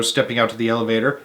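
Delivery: ordinary delivery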